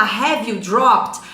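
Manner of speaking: slow, loud model question. Shown as a demonstration. Teacher models the question slowly for the class. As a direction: stretch the question out. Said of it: "Se eu perguntar".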